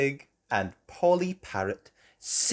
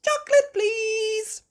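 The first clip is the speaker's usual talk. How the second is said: very high, loud little lamb; Susie Sheep voice; 7 dB louder than usual. A narrator's reading. Susie Sheep ordering from the boat, very high voice. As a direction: ask sweetly like a small sheep. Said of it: "Susie Sheep said".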